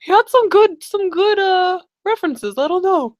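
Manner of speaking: high-pitched, wavering excuse; hesitant defence. Acted. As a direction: defend a bad choice half-heartedly. Acted hesitant defence of the applicant.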